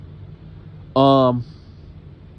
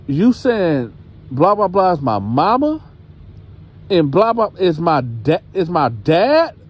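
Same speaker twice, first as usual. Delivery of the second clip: incredulous; 5 semitones higher than usual. Retold or imitated. Girl questions who her parents are, incredulous.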